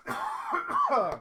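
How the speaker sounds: loud coughing fit; real cough. Real, not acted. Host with hay fever, coughing loudly. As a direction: cough hard from a rough throat. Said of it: "my throat is completely gone".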